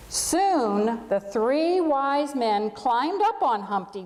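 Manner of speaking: put-on gentle storybook reading for children; story narration. A narrator's reading. Pastor reading a camel story to the congregation, storybook narration. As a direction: tell it like a bedtime tale to the whole room.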